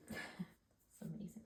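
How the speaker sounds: slow, breathless whispered laugh; excited. Real, not acted. Reader overwhelmed by the energy of the reading, laughing whisper. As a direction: giggle it out under your breath, overwhelmed. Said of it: "I'm getting, like, so excited".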